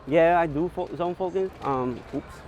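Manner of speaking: ordinary delivery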